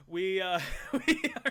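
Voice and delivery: breaking into laughter mid-sentence; words dissolve into a high laugh. Real, not acted. Laughing at own joke.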